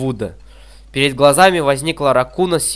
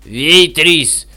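loud bellow across the tavern; roaring call; 3 semitones higher, 4 dB louder, slower than usual. A narrator's reading. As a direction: call out loudly over the room. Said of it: "взревел на весь кабак". (громкий рёв на весь кабак)

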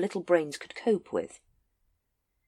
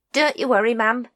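cheerful; 6 semitones higher, 5 dB louder than usual. A narrator's reading. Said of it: "volunteered Nobby cheerfully".

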